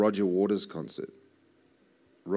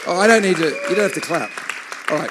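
higher, louder amused protest; loud surprise; 9 semitones higher, 11 dB louder, faster than usual. Real, not acted. Telling the clapping congregation they needn't, louder. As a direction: call out over the clapping.

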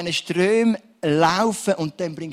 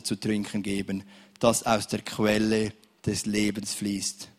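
slow, weighty reading of "Nun ist alles erfüllt"; solemn scripture reading; 3 semitones lower, 3 dB louder than usual. Real, not acted. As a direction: read the verse slowly and solemnly. Reads Bible verse solemnly.

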